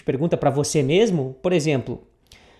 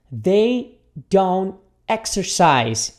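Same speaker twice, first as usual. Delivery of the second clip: very slow, word by word; repeat-after-me model; much slower than usual. Shown as a demonstration. Teacher models the negative for students, very slowly. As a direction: break it into long separate words. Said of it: "repita comigo aqui".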